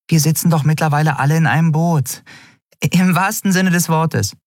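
bright little creature voice; small creature voice. A narrator's reading. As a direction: play the tiny flier, chirpy and wry. Nelen, small flying creature.

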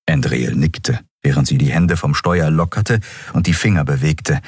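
ordinary delivery, nothing marked

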